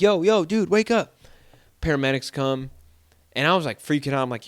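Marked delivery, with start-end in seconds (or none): 0.0-1.2 loud, high urgent calls to wake him; calling out. Retold or imitated. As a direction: shout to rouse a man lying on the ground.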